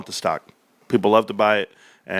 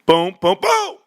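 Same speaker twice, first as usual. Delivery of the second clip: much higher, popping boom boom; excited boom; 6 semitones higher, 3 dB louder, slower than usual. Real, not acted. Pointing at chart breakouts, excited high booms. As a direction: pop it like fireworks.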